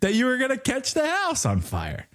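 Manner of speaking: high, exasperated mock defence; incredulous protest. Husband blamed for the fire, mock indignation. Acts out argument with wife, laughing.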